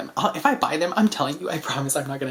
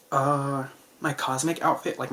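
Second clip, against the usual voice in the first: ordinary delivery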